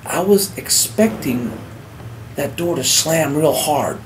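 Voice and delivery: ordinary talk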